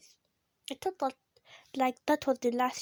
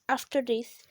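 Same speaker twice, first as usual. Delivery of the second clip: ordinary talk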